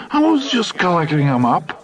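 high, self-righteous protest. A narrator's reading. Hap defending himself to Bozeman, righteously high. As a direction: insist you did nothing wrong. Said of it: "Hap said righteously".

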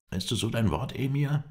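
high-pitched angry question; indignant. A narrator's reading. Mohammed Emin challenges the Emir's word, indignant. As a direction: challenge him, pitch climbing sharply on the title. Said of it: "zürnte Mohammed Emin".

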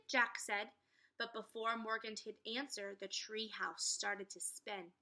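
usual speaking voice

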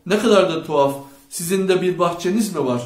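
loud, baffled questioning; confused. A narrator's reading. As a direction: exclaim in mild bewilderment. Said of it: "aklı karışmış bir halde".

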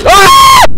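very high, piercing loud shriek; real jump-scare shriek; startled scream. Real, not acted. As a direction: scream suddenly in fright.

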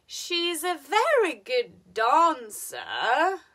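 VERY high, DANCER dips and rises; slow and loud, swoop "dancer" upward; hinting at a but on "dancer"; fall-rise intonation. Shown as a demonstration. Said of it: "we are trying to hide a".

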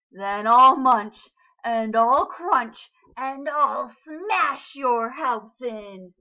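loud, slow roaring shark. A narrator's reading. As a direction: roar each threat slowly. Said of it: "roared the shark".

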